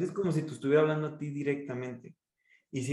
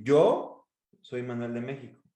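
YO raised and punched, then "soy Manuel de México" dropped low; stress on YO; 3 semitones lower than usual. Shown as a demonstration. Coach demonstrating key-word emphasis, "Yo" raised and set apart. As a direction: lift "Yo", pause, let the rest fall. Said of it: "subir el tono en una pala".

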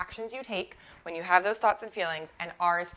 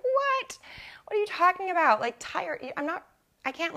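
high-pitched incredulous "What?", replaying her outrage; retold indignant outburst; 11 semitones higher than usual. To the coach about tiredness, a retold indignant high protest. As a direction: re-enact the disbelief, pitched way up. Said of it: "I got super angry and I was like".

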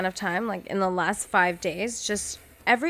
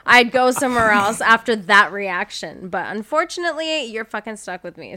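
sassy comeback; 7 dB louder than usual. Pretend. Snapping back at imagined cashier.

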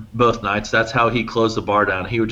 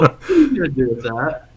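mildly incredulous, raised pitch; amused disbelief; 5 semitones higher than usual. Retold or imitated. Bystander to bouncer holding a nightstick, amused disbelief. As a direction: question the weapon like it's absurd.

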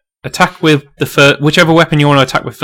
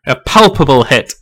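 usual speaking voice

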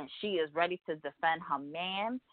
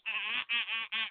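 short repeated "ah" bursts imitating bickering; bitchy mock yapping; 3 semitones higher, 4 dB quieter than usual. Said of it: "all bitchy and shit".

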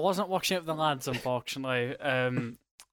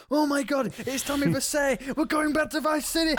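high, loud, thrilled gushing; excited; 11 semitones higher, 4 dB louder than usual. Retold or imitated. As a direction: squeal with fanboy joy. Retelling hoping for Vice City.

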